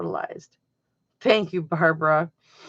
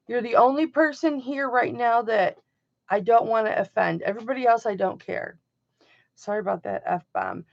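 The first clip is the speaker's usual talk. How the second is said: ordinary talk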